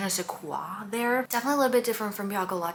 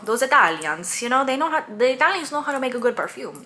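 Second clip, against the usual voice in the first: put-on an Italian accent that slides into Cuban, louder and higher; attempted Italian accent; 4 semitones higher, 6 dB louder than usual. Praising Italian perfume makers in a botched Italian accent. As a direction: try for Italian, land on Cuban. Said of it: "This is the Cuban accent coming out".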